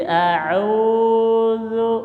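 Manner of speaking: "a'udzu" stretched slowly, ain held; slow model a'udzu. Shown as a demonstration. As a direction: drag "a'udzu" out, pause after the ain. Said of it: "gitu ya". ("a'udzu" dibaca lambat dengan jeda)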